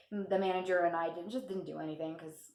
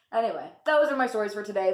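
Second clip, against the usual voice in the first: usual speaking voice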